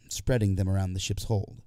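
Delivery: ordinary delivery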